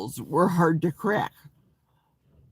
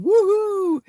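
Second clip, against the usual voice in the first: a high, loud delighted woohoo; thrilled whoop to the chat; cheer; 7 dB louder than usual. Real, not acted. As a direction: cheer for a viewer saying hello. Viewer greets her in chat.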